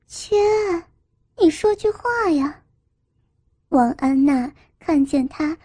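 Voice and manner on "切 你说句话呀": high-pitched exasperated demand; flustered, impatient plea. A narrator's reading. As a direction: press him for an answer, pitch jumping up. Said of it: "气急地直嚷".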